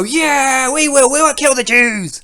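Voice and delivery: high, loud mob yell; hammed-up rallying roar; mock crowd cheer. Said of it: "they're meant to all go".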